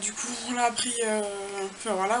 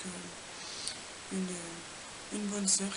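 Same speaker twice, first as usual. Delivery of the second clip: whispering; 4 semitones lower, 7 dB quieter, much slower than usual. Real, not acted. Quiet aside after church meeting.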